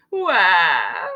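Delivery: drawn-out mock crying wail; cartoon boo-hoo cry; upset wail. A narrator's reading. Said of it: "Taya's very upset".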